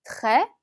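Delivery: très with a firmly stressed r; très, r sound pushed stronger; stronger French r in très. Shown as a demonstration. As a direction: lean hard on the r of très. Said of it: "에흐의 발음을 조금 더 강하게 주시라고 했죠".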